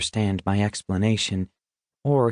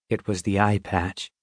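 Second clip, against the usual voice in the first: usual speaking voice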